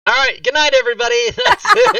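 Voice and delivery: high, loud laughing goodbye; mock sign-off. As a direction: squeal a fake goodnight. Fake sign-off, high squeal.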